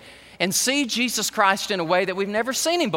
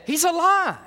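joyful announcement; 9 semitones higher than usual. Retold or imitated. Angels' announcement retold.

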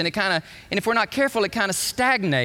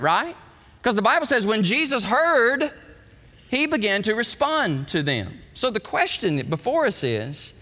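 ordinary delivery

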